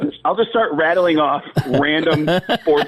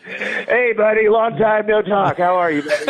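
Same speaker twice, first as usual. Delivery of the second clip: higher, hearty buddy greeting; Tom Arnold; 7 semitones higher than usual. Retold or imitated. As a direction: greet him like an old pal, pitched up. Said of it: "And Tom Arnold said".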